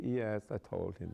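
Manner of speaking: lightly imitated German-accented queen; a hint of German accent. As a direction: mimic her German accent. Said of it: "she talked like this".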